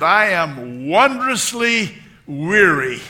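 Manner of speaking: put-on slow, loud, high old-lady declaration; hammed-up imitation, drawn out; Dorothy Boyd. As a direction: stretch each word out grandly as an elderly woman. Retold elderly woman's declaration.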